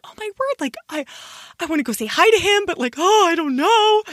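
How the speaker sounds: high, nervous and quick; flustered about saying hi; nervous excitement. Retold or imitated. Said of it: "I was kinda nervous".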